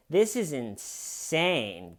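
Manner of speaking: slow, half-hearted mock outcry; unenthusiastic repeat. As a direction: imitate her outrage losing steam, drawn out. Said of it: "it doesn't have the enthusiasm".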